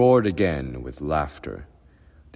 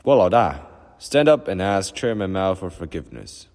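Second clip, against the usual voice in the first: much louder and higher, a public command; loud summons; 5 semitones higher, 7 dB louder than usual. A narrator's reading. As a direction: order him to his feet in front of everyone. Official denouncing peasant at meeting.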